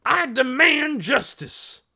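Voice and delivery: high, loud, drawn-out demand; roar. A narrator's reading. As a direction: roar it out word by word. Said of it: "he roared".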